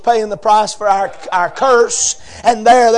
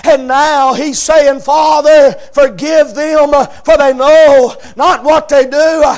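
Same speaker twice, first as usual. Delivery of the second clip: loud, high, drawn-out preaching cry; crying out the sermon climax; preaching at full force; 8 semitones higher, 7 dB louder than usual. Acted. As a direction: lift the congregation with rising fervor.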